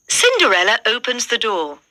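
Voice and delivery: plain reading voice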